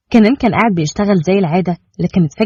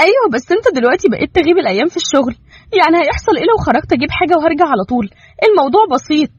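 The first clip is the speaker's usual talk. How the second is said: higher wife voice, fast and insistent; voiced wife, quick protest; 9 semitones higher, faster than usual. Wife insisting to Kenan that it's simple, fast. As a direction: rush out the argument.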